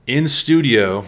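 loud, ironic "in studio", punched high; air quotes. Acted. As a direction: say "in studio" as if it is not a real studio. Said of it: "well, I'm doing air quotes".

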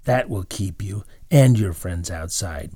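usual speaking voice

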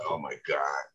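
low, soft inward groan of words; embarrassed aside. Retold or imitated. Standing up in church before everyone, embarrassed aside. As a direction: drop it down low, under your breath.

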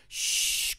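drawn-out voiceless "shh"; imitated shushing for silence; hushing sound. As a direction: hush the room. Mimes homeboys keeping quiet.

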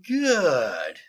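loud, drawn-out, falling approval; satisfied general. Acted. General pleased by his lieutenant's report, satisfied. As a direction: savor the word.

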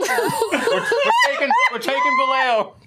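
laughing shout. Acted. Mock invasion declaration, laughing.

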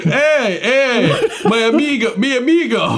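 high, smooth Spanish-flavored greeting; suave gangster voice. Acted. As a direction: schmooze him like a smooth criminal. [name] greets the guard in character.

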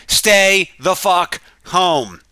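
slow, word-by-word exasperated command; emphatic imagined plea. Acted. Commissioner finally telling the public what he can't say, slow and emphatic. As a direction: land each word hard, with spaces between.

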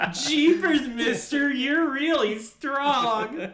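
high, slow gushing golly-gee voice; wholesome 1950s kid. Pretend. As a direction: gush like an awestruck kid. Mock swooning over his strength.

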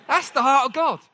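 ordinary talk, nothing marked

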